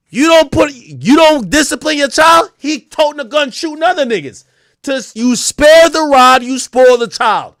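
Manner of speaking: high, loud, impassioned preaching; loud fervent scolding; heated rant. Real, not acted. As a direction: drive the point home loudly. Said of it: "ranting loudly about discipline".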